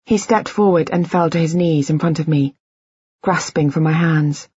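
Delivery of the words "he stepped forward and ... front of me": ordinary delivery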